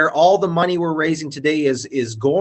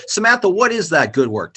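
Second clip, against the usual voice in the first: usual speaking voice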